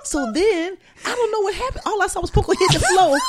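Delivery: real laughter breaking through high, loud words; can barely get the words out for laughing; laughing hard. Real, not acted. Retelling the dog falling, cracking up.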